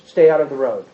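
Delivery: put-on low firm warning to a child; parent warning. Parent telling a toddler to stay out of the road, lightly stern. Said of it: "what kind of language do you use?".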